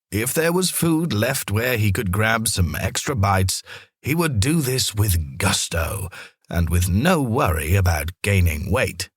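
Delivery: usual speaking voice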